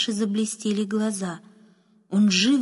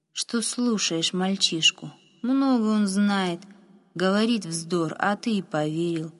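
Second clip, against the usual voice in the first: ordinary delivery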